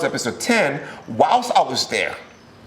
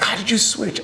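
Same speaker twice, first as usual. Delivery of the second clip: amazed crew; 9 semitones higher than usual. Retold or imitated. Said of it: "they're like".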